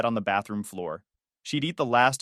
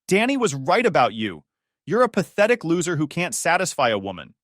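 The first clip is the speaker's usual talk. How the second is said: angry yelling; 5 semitones higher, 4 dB louder than usual. Acted. Nadia yelling insults.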